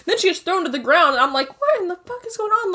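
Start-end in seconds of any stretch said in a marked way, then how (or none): 1.6-2.7 high-pitched retold disbelief; shocked disbelief. Reacting to friend thrown to the ground, shocked disbelief. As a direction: imitate your own baffled reaction.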